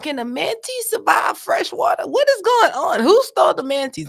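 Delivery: high, loud outraged questions; worked-up yell; excited outburst. Real, not acted. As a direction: shout the disbelief. Outraged rant about stolen manatee.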